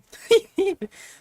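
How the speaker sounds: shrieking burst of laughter; high squealing laugh. Real, not acted. Laughing fit after shout.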